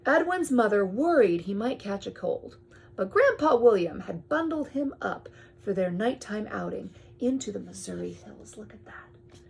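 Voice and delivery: ordinary delivery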